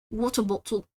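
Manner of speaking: non-rhotic British "Water bottle"; put-on British "Water bottle"; British accent. Shown as a demonstration. Comparing accents on test words.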